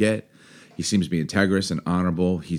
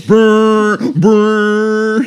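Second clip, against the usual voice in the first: high, loud mouth air-horn blasts; playful air horn noise; air horn imitation; 11 dB louder than usual. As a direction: blare it like a stadium air horn. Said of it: "I'll have air horns".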